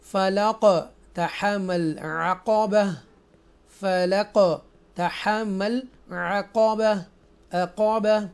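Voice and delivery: अक़बह with a bouncing echo on the q and b; qalqala echo. Shown as a demonstration. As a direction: let अक़बह's stops rebound loudly and slowly. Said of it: "ऐसे पढ़ेंगे हरूफ कलकला".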